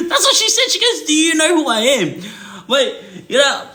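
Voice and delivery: high, squeaky words through laughter; real laughing. Real, not acted. Storyteller quoting old lady's challenge, helpless laughing. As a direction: try to talk while cracking up. Cracks up retelling the old lady.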